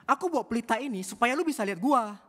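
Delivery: very loud and high, screaming at the walker; furious shout. Retold or imitated. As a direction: scream it out in rage. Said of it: "dengan keras dia ngomong". (teriakan marah yang sangat keras)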